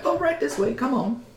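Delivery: put-on high, soft beckoning old woman; older lady. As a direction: wave the strangers in like a granny. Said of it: "an older lady comes over".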